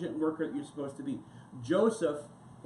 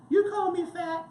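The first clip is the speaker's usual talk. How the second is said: sharp, much higher indignant squeak; offended retort; 13 semitones higher, 5 dB louder than usual. Retold or imitated. Offended woman snaps back at the helpful coworker, high and indignant. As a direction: bristle as if insulted.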